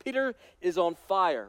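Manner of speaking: ordinary delivery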